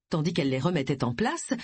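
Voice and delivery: ordinary delivery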